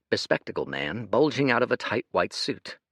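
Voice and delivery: ordinary delivery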